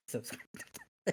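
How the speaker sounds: whispered plug; whispering. Pretend. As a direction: breathe it out as a whisper. Repeats plug as aside.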